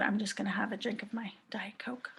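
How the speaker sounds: a little private aside, whispered. Real, not acted. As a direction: whisper it under your breath. Aside while audience types.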